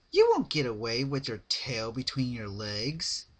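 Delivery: high, loud teasing character voice; joking Pinkie. A narrator's reading. Pinkie teasing Rarity before tugging her tail, high joking voice. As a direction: tease her in a bright high cartoon voice. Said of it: "joked Pinkie Pie".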